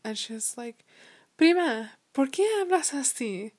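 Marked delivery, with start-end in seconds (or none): 1.4-3.5 put-on higher, louder child's Spanish; imitated little cousin. Little cousin on the phone questioning her accent, imitated. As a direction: lightly mimic a puzzled young cousin.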